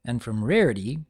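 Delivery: usual speaking voice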